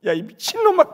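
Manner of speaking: retold father's outburst, high and loud; angry scolding yell. Father scolds his son over the stolen glove, yelling. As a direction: yell at your son in outrage.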